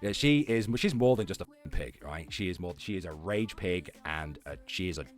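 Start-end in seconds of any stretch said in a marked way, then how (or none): none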